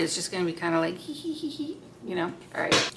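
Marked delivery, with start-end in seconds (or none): 1.0-1.8 schoolgirl giggle. Retold or imitated. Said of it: "little school girl boy crush".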